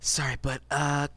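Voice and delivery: hushed, lightly whispered apology; whispered aside. Acted. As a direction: murmur it softly over your shoulder. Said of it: "He kinda turns back and whispers".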